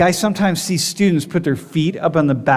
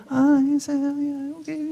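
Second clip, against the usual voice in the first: high, much softer, gentle polite reply; polite Korean agent; 10 semitones higher, 5 dB quieter than usual. Retold or imitated. Korean security agent greeting traveler, gentle and polite. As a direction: sound soft and deferential like a courteous official.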